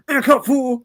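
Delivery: a quick retort broken by laughter; lightly voiced Mister T, laughing; a laughing hint of a tough guy; quick Mister T line. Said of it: "he delivered his line so quickly".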